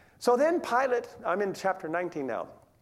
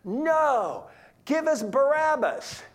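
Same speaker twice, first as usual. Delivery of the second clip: louder, higher, slower shout for Barabbas; crowd shout; 7 semitones higher, 5 dB louder, slower than usual. Retold or imitated. Crowd yelling back at Pilate, a mild mock shout. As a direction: demand him like an angry crowd. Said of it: "they shouted back".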